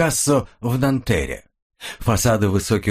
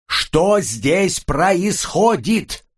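slow emphatic shout; 8 semitones higher, much slower than usual. Acted. Repeats question over ear-protectors.

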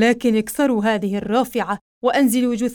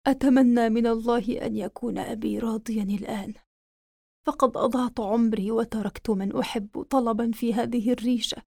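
put-on very old faint voice; 4 dB quieter than usual. Dying prince's last words.

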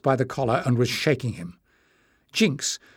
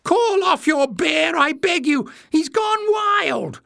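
very high, squealing appeal; slow, high-pitched begging; spluttering plea; 4 dB louder, slower than usual. A narrator's reading. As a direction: squeal for help, stretching the words. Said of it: "he spluttered".

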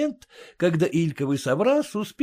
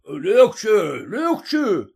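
raised stern warning call; warning policeman; 6 semitones higher, 5 dB louder, much slower than usual. A narrator's reading. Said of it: "предостерегает ямщика".

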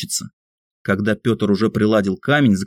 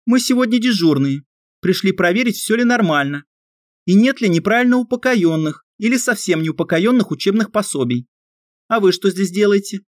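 put-on high bright girl voice; girl's voice; 11 semitones higher than usual. A narrator's reading. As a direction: speak as a young girl.

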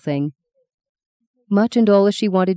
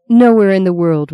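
raving; 2 semitones higher, 7 dB louder than usual. Retold or imitated. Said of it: "raved Luke".

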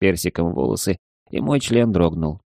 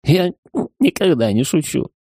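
tipsy giggle, pitched higher; giggly drunk; 8 semitones higher than usual. Acted. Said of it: "пьяно хихикнул я".

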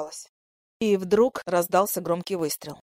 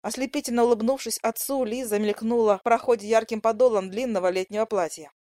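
usual speaking voice

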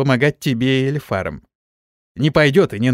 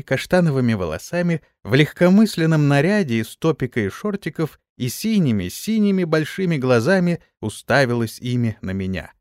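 usual speaking voice

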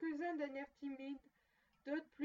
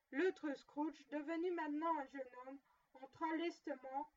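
ordinary delivery, nothing marked

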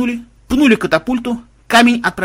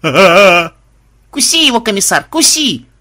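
high, loud gleeful egging-on; joyful shouting; 5 semitones higher, 6 dB louder, much slower than usual. A narrator's reading. Granddaughter urging the little dragon on, gleeful shout. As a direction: cheer the attack on, stretching the call. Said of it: "радостно закричала внучка".

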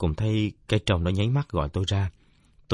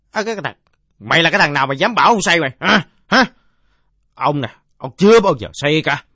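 put-on loud, high drunken bluster; indignant drunkard; 8 semitones higher, 11 dB louder than usual. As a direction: bellow like an offended old drinker. (giọng ông say rượu quát to, tự ái)

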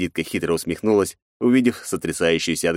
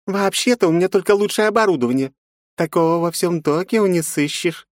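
purring femme fatale; 12 semitones higher, 3 dB louder than usual. Acted. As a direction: purr it like a temptress. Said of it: "промурлыкала Жанна". (мурлыкая, соблазнительно)

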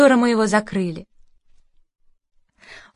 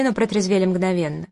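plain reading voice

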